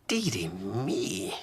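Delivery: slow, lightly voiced dismay; a mild old-lady tut; slow dearie me; wee Granny. Granny forgot sink.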